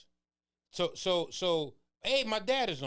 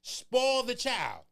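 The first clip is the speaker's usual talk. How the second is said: preaching; 7 semitones higher, 5 dB louder, much slower than usual. Real, not acted. Preaching scripture emphatically.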